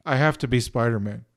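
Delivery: ordinary delivery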